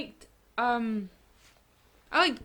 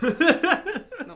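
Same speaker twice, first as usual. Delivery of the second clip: laughing burst, louder and higher; 9 semitones higher, 9 dB louder than usual. Real, not acted. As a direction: break out laughing on the word. Laughing while denying.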